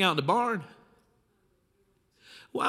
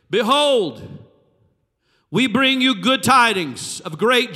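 loud angel announcement; 5 semitones higher, 6 dB louder than usual. Acted. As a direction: herald it to the shepherds like an angel from heaven.

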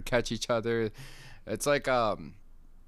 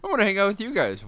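mock whiny kid; 12 semitones higher, 9 dB louder, faster than usual. Mocking a childish whine.

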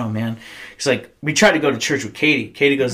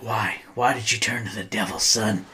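quiet cop question; 2 semitones lower, 7 dB quieter than usual. Retold or imitated. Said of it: "and he was just like".

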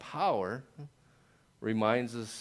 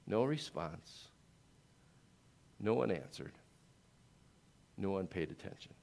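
softer, falling, slowed drop; quiet contrast; 2 semitones lower, 5 dB quieter than usual. Acted. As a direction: let it fall into silence after the noise. Silence after the shouting.

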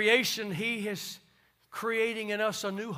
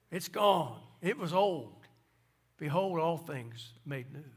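ordinary talk

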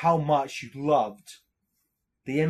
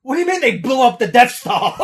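high, loud mock disbelief; shocked Vader; 7 semitones higher, 9 dB louder than usual. Vader hearing bad news on the phone, mock shocked. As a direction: squeal out the disbelief. Vader voiced in retold sketch.

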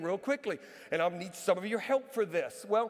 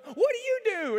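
nosy stranger; 13 semitones higher, 6 dB louder than usual. Retold or imitated. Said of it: "And he goes".

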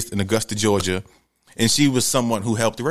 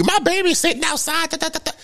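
shrill, way-up-high panicked mother; mama losing it over her baby stranded outside; mama going off. Retold or imitated.

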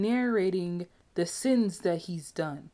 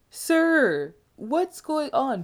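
high, louder, slow "Sir, what's going on?"; mock exasperation; 6 semitones higher, 6 dB louder, much slower than usual. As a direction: call him out in disbelief. Mock addressing Pattinson's bad accent.

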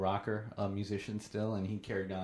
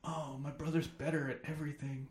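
soft, pitched-up mock whine; mock Bob whining about his brother; self-pitying whine; 5 semitones higher, 5 dB quieter than usual. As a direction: sulk it like a jealous kid brother.

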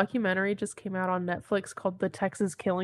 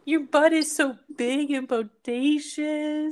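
high, slow pet-talk voice; dog voice; 7 semitones higher, slower than usual. Acted. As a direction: coo it teasingly to the dog. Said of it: "My dog voice".

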